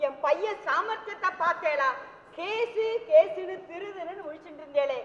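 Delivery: usual speaking voice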